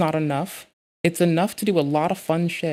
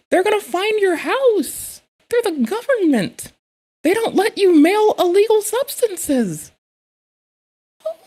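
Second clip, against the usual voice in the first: much higher, loud, panicked-laughing yells; frantic shriek; 8 semitones higher, 5 dB louder than usual. Real, not acted. Streamer warning the creator the postmaster is coming, high frantic shrieking. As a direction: scream the warning like it's a disaster.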